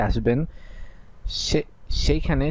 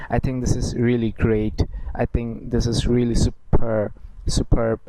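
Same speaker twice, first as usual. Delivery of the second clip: slow English example; much slower than usual. Shown as a demonstration. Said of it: "আমরা একটা expression ব্যবহার করে থাকি".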